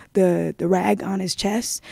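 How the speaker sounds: normal talking voice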